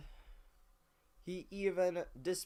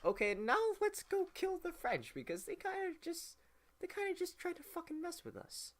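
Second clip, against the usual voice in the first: much higher, mocking voice of eager patriots; mock riled-up Americans. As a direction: play the hotheaded crowd high and silly. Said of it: "people in the U S went like".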